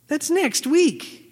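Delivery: high, slow disbelieving whine; incredulous. Retold or imitated. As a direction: stretch it out, stunned it's so soon. Stunned reply to last-minute invitation.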